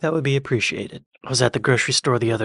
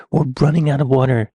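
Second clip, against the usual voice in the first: ordinary talk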